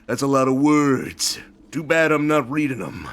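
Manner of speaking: smug action hero quip, lightly voiced; Duke Nukem impression. Streamer quoting Duke Nukem with a hint of cocky swagger. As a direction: toss it off like a cocky tough guy. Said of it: "who's saying things like".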